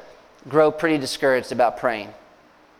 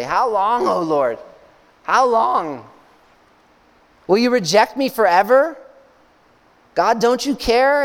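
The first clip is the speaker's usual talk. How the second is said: higher, anguished plea to God; pleading lament; 6 semitones higher than usual. Retold or imitated. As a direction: cry out to God as David. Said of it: "He'll often say".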